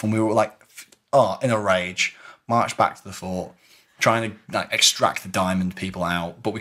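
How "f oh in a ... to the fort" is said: ordinary delivery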